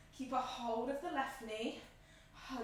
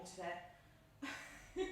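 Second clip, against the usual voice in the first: laughing; 6 semitones lower, 8 dB quieter than usual. Real, not acted. Glasses steaming, laughs at herself.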